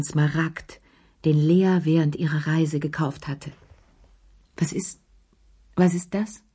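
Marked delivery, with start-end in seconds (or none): 4.6-6.4 stammering. A narrator's reading. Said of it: "stammelte er".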